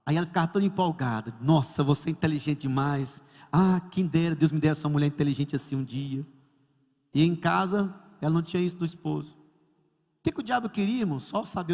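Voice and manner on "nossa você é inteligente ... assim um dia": lightly imitated admirer, pitched low; flattering male coworker. Coworker praises a married woman's intelligence, imitated low-pitched flattery. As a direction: play the wistful flatterer in a lower voice. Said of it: "O rapaz do meu trabalho, quando eu chegava, ele dizia".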